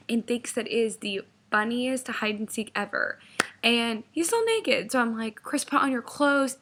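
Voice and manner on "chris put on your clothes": higher, louder scolding, mildly mad; retold annoyance. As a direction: imitate ordering a naked boyfriend around. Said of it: "I was, like, mad at this point".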